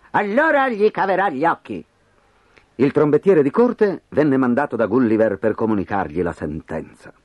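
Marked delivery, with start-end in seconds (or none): 0.1-1.8 vocina più acuta, secca e stizzita; l'imperatore cede di malavoglia; sbottando. A narrator's reading. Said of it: "sbottò Golbasto". (l'imperatore concede con stizza, voce più alta)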